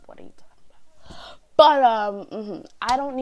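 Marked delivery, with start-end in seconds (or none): 0.1-1.4 whispered, incredulous repeat; baffled whisper. Retold or imitated. Student baffled by teacher's accusation, whispering. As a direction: whisper it in disbelief.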